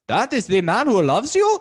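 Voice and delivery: high, loud put-on opera tenor voice. Host imitates [name] mocking [name]'s goodbye, high and loud. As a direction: ham up the pompous tenor. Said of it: "That's the best [name] voice I can do".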